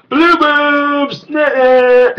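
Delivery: very high, loud squeal; cartoon voice. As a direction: shriek it like a cartoon. Repeats joke in cartoon voice.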